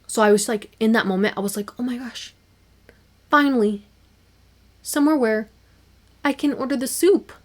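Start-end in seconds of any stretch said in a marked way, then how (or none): 1.8-7.3 higher, thrilled inner voice; a rush of joy at last; giddy mock-excited thought; excited relief. Relieved at finally ordering soup.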